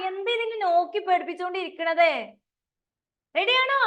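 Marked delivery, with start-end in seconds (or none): 3.3-3.8 high excited call. Real, not acted. Teacher rousing kids online.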